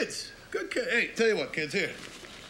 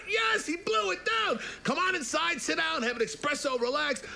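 frantic high pig; 10 semitones higher than usual. Acted. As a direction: squeal out the news, then try to calm.

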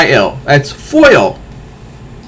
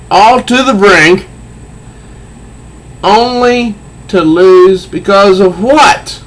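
high, slow bitter lament; bitter Riddler; 6 semitones higher, slower than usual. Acted. Riddler lamenting his failed war, high and slow. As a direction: rage slowly at the loss. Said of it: "the Riddler says".

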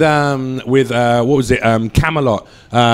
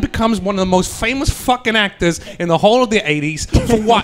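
higher, ranting Boston comic voice; exasperated Boston accent rant, pitched up; Bill Burr Boston impression; 7 semitones higher than usual. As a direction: riff like an incredulous Boston stand-up. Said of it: "That was my Bill Burr".

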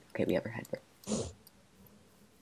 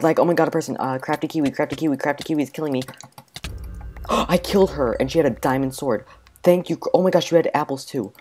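excited; 8 dB louder than usual. Real, not acted. Player thrilled after killing a rival, loud and excited. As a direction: blurt it out in a thrill.

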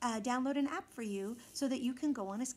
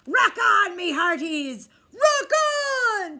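much higher, very loud cheer; cheering crew; 9 semitones higher, 13 dB louder, slower than usual. A narrator's reading. Pirate crew cheering rock on. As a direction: whoop it like a rowdy crew. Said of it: "cheered the crew".

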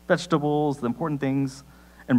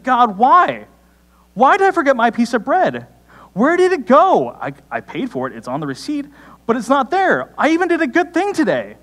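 high, loud hammed-up wail to God; mock indignant protest; 9 semitones higher, 5 dB louder than usual. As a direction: play up an aggrieved complaint. Acting out complaint over lost bread.

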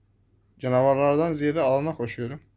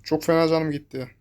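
usual speaking voice

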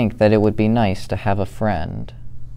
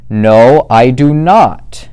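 growled; 3 semitones higher, 9 dB louder than usual. Acted. Smelly Bear growling back in the bears' quarrel, loud. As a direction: bark it back, arguing hard. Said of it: "growled Big Smelly Bear back".

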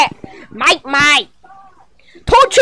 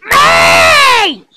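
distressed call; 7 semitones higher than usual. Real, not acted. Kid calling for his mate in panic, wailing shout. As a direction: cry it out for help.

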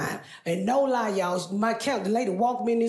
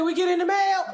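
mama's voice; 7 semitones higher than usual. Retold or imitated. Comic who plays her mother asking about mail. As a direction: play the nagging mom sending kids. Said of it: "Mama say".

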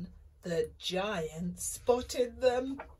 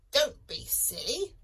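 cackling chicken; 5 semitones higher, 4 dB louder than usual. Acted. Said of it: "cackled the chicken".

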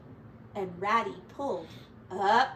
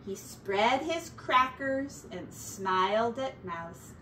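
ordinary delivery, nothing marked

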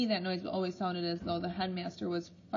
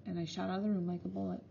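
ordinary delivery

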